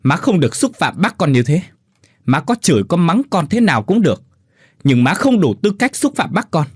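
anguished protest to mother-in-law; anguished outcry. Acted. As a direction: shout back in hurt fury. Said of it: "uất hận gào lên".

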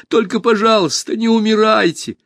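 put-on high, child's pleading cry; tearful plea. A narrator's reading. Alisa begging the dying empress, high tearful plea. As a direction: beg in a high, crying voice. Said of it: "заплакала она".

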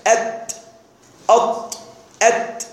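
أت، أط، أت as separate sounds, light t against emphatic ṭ; isolated consonant demo. Shown as a demonstration. Teacher showing both letters come from the tongue, isolated consonant demo. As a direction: sound out أت، أط، أت one by one. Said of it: "التاء مخرجها من اللسان".

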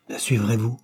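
voix plus haute de mourant, solennelle; mourant qui bénit. Acted. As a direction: dernier adieu d'un père à ses enfants. Mourant parle à sa famille. (père mourant, voix haute et grave d'adieu)

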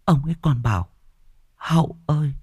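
put-on high girl's voice, tearful and scared; whimpering and sobbing. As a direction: sob it out to your father. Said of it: "mếu máo nức nở".

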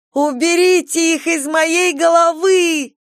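high, loud, slow hysterical scream. A narrator's reading. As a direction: scream it in terror. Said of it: "Марджери кричала".

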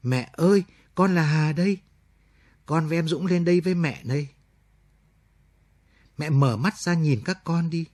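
a daughter's mild sobbing plea; crying out to mother. Acted. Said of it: "khóc rú lên". (khóc gọi mẹ, nhẹ nhàng)

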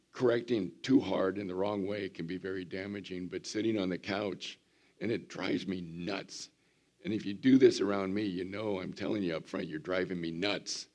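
normal talking voice

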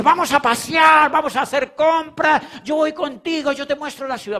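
agudo y fuerte, invitando con ganas; voz alegre de anfitriona entusiasta; mujer efusiva imitada. Retold or imitated. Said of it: "y ella me dice". (la amiga lo invita a pasear, aguda y animada)

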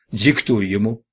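ordinary delivery, nothing marked

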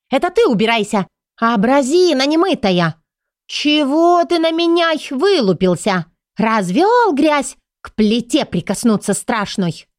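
high, shrill angry woman; shrill scolding cook. A narrator's reading. Ru shouting back at cook.

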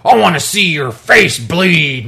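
slow, loud menacing growl-shout; brute yelling. Retold or imitated. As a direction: snarl it like a raging giant. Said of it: "they'll start yelling things like".

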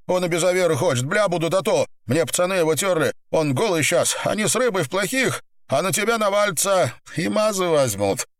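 high, urgent pleading voice; pinned gangster, pleading. Acted. Pinned man explains himself to boss, high urgent pleading. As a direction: plead your case in a high voice.

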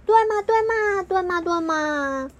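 egging their friend on, excited; eager chorus. Acted. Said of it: "蜡笔们异口同声地说".

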